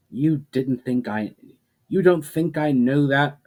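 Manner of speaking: rising to a near shout in disbelief; almost shouting, incredulous. A narrator's reading. Ushara to the portal voice, near-shouting in disbelief. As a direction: push it up, she can't believe her ears. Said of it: "Ushara almost shouted incredulously".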